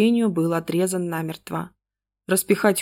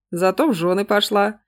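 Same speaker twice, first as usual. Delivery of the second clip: usual speaking voice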